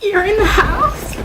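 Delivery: mock panic. Reacting to the pretend killer.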